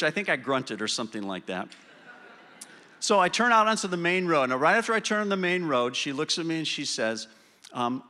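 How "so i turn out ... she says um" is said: ordinary delivery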